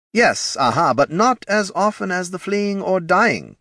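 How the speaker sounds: high, flustered executive protest; high, insistent marketing boss; head of marketing, placating. Acted.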